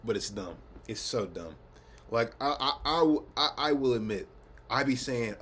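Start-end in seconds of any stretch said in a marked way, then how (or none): none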